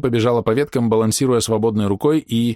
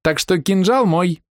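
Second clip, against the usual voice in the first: put-on high, slow, teasing child voice; cheeky little girl; 10 semitones higher, 3 dB louder, slower than usual. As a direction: gloat like a bratty little girl. Cheeky girl claims the dagger.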